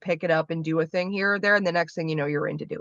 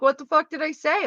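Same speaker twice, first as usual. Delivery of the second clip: 1 baffled friend; 9 semitones higher than usual. Retold or imitated. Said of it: "he'd be like".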